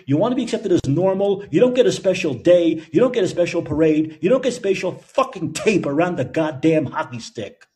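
loud, high-pitched angry tirade; exasperated rant. Real, not acted. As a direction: tell them off, loud and fed up.

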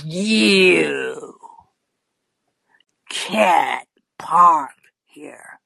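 slow, drawn-out low threat; word-by-word intimidation; menacing. Acted. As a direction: imitate a looming man, stretching each word with long pauses. Stocky man leans in, menacing.

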